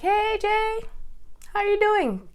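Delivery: very high, loud sing-out hello; exaggeratedly friendly, high-pitched hail; chirpy greeting. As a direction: greet him in a put-on squeaky voice. Acting out her chirpy text greeting.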